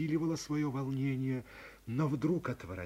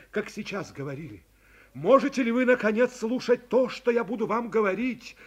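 louder, higher confession of love; passionate young man; 7 semitones higher, 13 dB louder than usual. A narrator's reading. Young man confessing love to the girl, passionate. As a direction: pour out a heart that can't stay silent.

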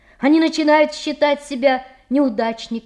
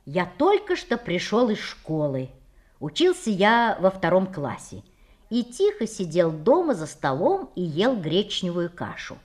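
plain reading voice

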